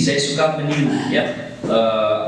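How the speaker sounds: ordinary delivery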